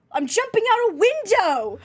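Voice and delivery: exaggerated British voice, high, slow; bad British accent. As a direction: say it like a hammed-up Brit. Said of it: "I don't know why I gave him an accent there".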